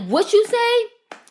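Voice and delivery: high, slow challenging demand; drawn out and provoking; confrontational. Retold or imitated. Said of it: "she's like".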